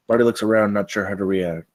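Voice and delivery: ordinary talk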